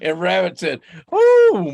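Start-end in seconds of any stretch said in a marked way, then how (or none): 1.1-1.7 high, loud surprised oh; Rabbit's surprise. Acted. Rabbit marvels at the frozen river, high surprised squeal. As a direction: squeal it in amazement.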